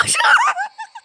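real laughter that jumps up high; shrieking laugh. Real, not acted. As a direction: let a squealing laugh burst out. Bursts out laughing at the screen.

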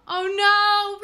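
gasp, then high, loud dismay; horrified gasping outburst; alarmed. Real, not acted. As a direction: cry out in sudden alarm. Sees teammate dying in game.

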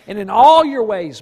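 preacher booming the verse; sudden loud emphasis. Real, not acted. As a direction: burst out loud on these words.

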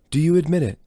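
high, loud demand; menacing demand. Acted. Rowan questioning Brahms at knifepoint, loud demand.

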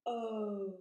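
O drawn out, rounding smaller as it glides; long vowel O. Shown as a demonstration. Teacher shows how the mouth moves, O stretched long. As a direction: stretch O firmly from open to tight lips. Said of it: "it gets smaller as we go".

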